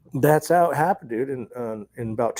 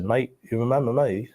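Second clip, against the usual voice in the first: imitated Australian accent; 2 semitones higher than usual. Australian agents testing whether he remembers them, imitated Australian accent. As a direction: put on an Australian accent for the quote. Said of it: "I hear this Australian accent".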